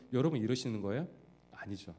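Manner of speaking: normal talking voice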